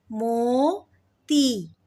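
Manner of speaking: मोती split into mo and a long tee; drawn-out syllables. Shown as a demonstration. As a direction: stretch the final 'ee' of मोती. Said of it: "ई का sound आ रहा है".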